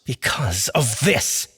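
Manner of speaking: slow, pointed answer; slow pointed retort; defiant. Acted. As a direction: lay it down slowly. Austin defiant.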